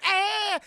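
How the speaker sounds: loud, high-pitched screech; imitated scream. Chris Tucker during the fight, imitated high scream. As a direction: shriek like a fighter mid-punch. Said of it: "He's screaming a whole lot".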